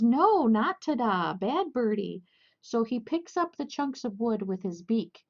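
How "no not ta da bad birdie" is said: slow, louder scolding; scolding the bird. Retold or imitated. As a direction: tell off a naughty pet. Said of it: "she goes".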